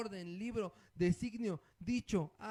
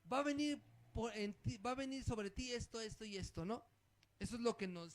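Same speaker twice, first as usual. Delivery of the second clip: ordinary talk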